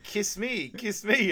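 high mock repeat; quoting a teammate mishearing the plea. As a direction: pitch up, playful mimicry. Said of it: "I think he's saying".

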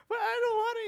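high whining kid, lightly played; a child refusing to come, mock whine; whiny child voice. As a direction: whine like a little boy.

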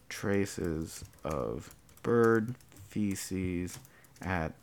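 very slow, quiet, word-by-word; hushed, halting read as it's written; slow dictation. Real, not acted. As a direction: dictate softly to yourself while typing. Typing out the story line.

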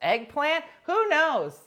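loud, high, flustered; loud embarrassed kid explaining; loud flustered answer. Retold or imitated. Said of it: "You're like".

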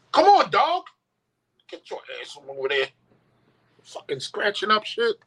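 a sharp high call at the dog, then grumbling; scolding dog. Real, not acted. Owner scolding the dog scratching things, sharp and high. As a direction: snap the dog away from the door.

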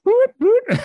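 very high, loud mock alarm whoop; car alarm imitation. The other car alarm answering, high loud mock whoops. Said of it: "this one would go off".

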